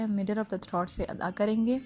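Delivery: ordinary talk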